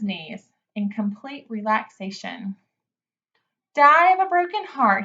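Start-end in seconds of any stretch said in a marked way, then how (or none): 3.7-5.0 high, loud sneering echo of Wilbur; mocking mimicry. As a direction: parrot his words back in a jeering voice. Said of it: "he mimicked".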